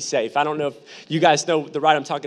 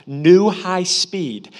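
dramatic reveal; 5 dB louder, much slower than usual. Acted. Scoreboard reveal for comic effect.

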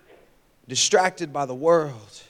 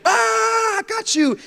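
higher mock triumphant cry. Kid surfacing to topple his brother, triumphant. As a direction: announce the win, higher. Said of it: "this triumphant".

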